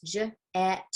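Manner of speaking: j and et said as separate sound chunks; segmenting sounds. Shown as a demonstration. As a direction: break jet apart into j, et. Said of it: "jet has three parts".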